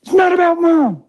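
put-on high, shouted boy's plea; desperate son.

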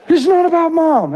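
put-on a distraught fourteen-year-old pleading with his dad, high; teenage son's voice. Son's line repeated.

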